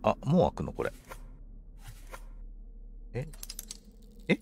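ordinary delivery

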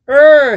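loud, high yell of alarm; falling scream. A narrator's reading. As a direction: yell out as you plunge down a chute. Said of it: "he screamed as he slid".

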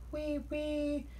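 very high, sustained peep-peep; imitated train whistle. A narrator's reading. Percy passing at the quarry, imitated whistle. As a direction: squeal like a small engine's whistle. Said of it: "he heard Percy's whistle".

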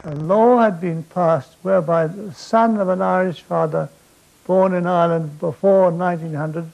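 ordinary delivery

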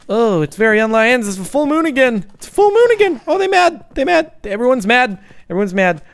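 shrill high panic, very loud; panicked shouting. Real, not acted. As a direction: shriek it, mobs are angry. Full moon panic.

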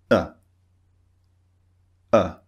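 "uh" as a very short, simple schwa; short neutral vowel. Shown as a demonstration. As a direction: say "uh" with relaxed, unrounded lips. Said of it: "It's a very short, simple sound".

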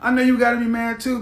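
normal talking voice

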